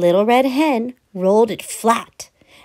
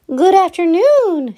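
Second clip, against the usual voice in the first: hen calling out; 7 semitones higher, 5 dB louder than usual. A narrator's reading. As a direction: call out the window like a hen. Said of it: "she said".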